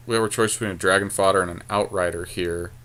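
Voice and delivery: normal talking voice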